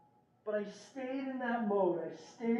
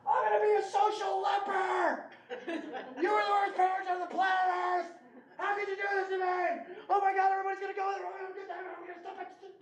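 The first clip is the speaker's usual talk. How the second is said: retold screaming teen; 9 semitones higher than usual. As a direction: shriek it like a furious teenager. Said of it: "she starts screaming at me at the top of her lungs".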